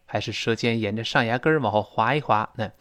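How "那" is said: ordinary delivery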